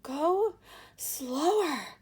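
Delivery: high, loud, winded plea; out of breath from the stairs, begging; panting. Acted. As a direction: gasp it out, short of breath. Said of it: "panted Missus Salt".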